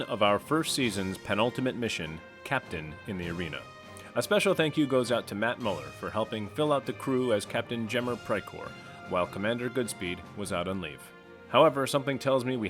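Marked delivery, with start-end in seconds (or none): none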